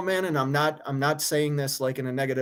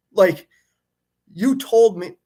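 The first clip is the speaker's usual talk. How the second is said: sudden higher, louder burst of frustration; angry outburst; 5 semitones higher, 6 dB louder, slower than usual. Real, not acted. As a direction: flare up at the shop. Venting anger at the shop.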